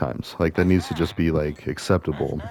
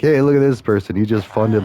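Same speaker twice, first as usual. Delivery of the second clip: higher, louder mock host announcement; game show host; 4 semitones higher, 5 dB louder than usual. Pitching charity as a game show, playful host voice. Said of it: "more like a game show".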